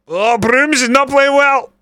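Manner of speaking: high-pitched exasperated wail; real alarmed dismay. Real, not acted. Gamer watching Brooms play badly, high dismayed cry. Dismay at player during match.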